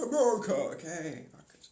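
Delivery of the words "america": high, loud hammed-up America cheer; mock patriotic cheer. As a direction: yell it like a cartoon patriot. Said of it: "We're like".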